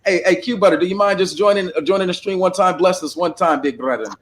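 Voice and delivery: ordinary delivery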